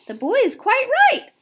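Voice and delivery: put-on old grasshopper seizing good news; relieved exclaim. A narrator's reading. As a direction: grasshopper backing up James.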